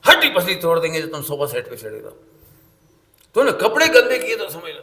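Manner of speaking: loud mock scolding; mother scolding. Mother loudly scolding her own child in front of guests. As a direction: threaten the child like an angry mother. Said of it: "एकदम चिल्लाए बच्चे पे".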